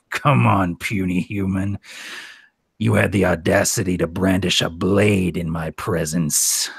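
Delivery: low menacing orc growl. Acted. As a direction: taunt the puny human like a brutish orc. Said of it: "I love that you did it in the orc voice".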